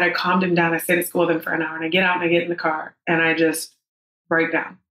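ordinary talk

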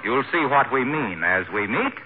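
ordinary talk

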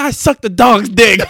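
loud, high and slow; drawn-out hammed-up confession; blurted mock confession. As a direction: yell it out as the punchline. Retold confession, blurted as punchline.

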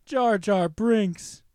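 loud, drawn-out name call without effect; mock announcer call. Host tries the bit before the effect works, loud drawn-out call. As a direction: belt the name slowly, dry.

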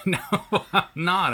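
denying it through laughter; laughing. Real, not acted. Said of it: "Are you being funny?".

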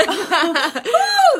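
laughing whoop up high; high laughing woo. Real, not acted. Host reacting to own squeak, high laughing whoop. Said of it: "that was high".

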